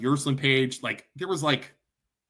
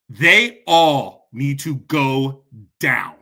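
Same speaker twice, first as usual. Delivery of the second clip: slow furious vow; 4 semitones lower, 5 dB louder, much slower than usual. Real, not acted. Furious rant at church leaders.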